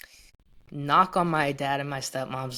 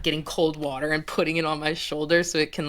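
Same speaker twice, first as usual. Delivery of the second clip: normal talking voice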